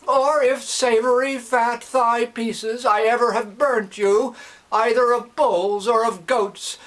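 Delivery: high, loud fervent prayer. Acted. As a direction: cry out to the god. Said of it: "Loudly the old man".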